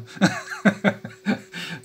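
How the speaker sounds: real, breathy chuckle at own joke; amused laugh. Real, not acted. Laughs at own American accent joke.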